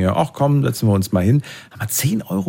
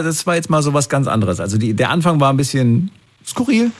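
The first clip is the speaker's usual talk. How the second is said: ordinary talk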